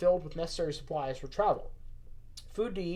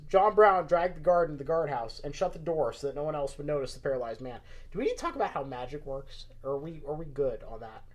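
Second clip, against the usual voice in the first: usual speaking voice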